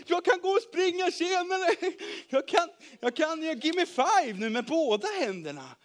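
high, loud excited rush; overjoyed. A narrator's reading. Healed man showing off his arm, overjoyed. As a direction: burst out with happiness. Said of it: "han var så glad och lycklig".

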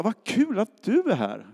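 high, slow happy welcome; delighted greeting. Retold or imitated. Colleagues hugging him in the meeting room, high gushing welcome.

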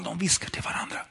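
unvoiced whisper on "de viskar till varandra"; preacher whispering to the audience at home. Shown as a demonstration. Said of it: "Jag vet inte om ni hör".

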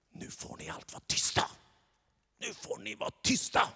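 whispered, scolding hush; hushing crowd. Acted. Crowd whispering sharply at the blind beggars. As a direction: crowd hissing at the beggars to be quiet.

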